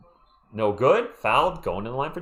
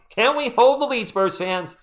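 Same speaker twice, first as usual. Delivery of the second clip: excited; 10 semitones higher, 4 dB louder, slower than usual. Real, not acted. Tense final minutes, asking fans.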